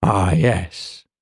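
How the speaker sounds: low, absent-minded acknowledgement; a bit absent. A narrator's reading. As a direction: answer low, as if half elsewhere. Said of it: "he said a bit absently".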